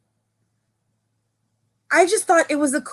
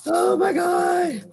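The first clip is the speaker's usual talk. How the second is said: amused disbelief; 5 semitones higher, much slower than usual. Real, not acted. Reacting amid the laughing fit.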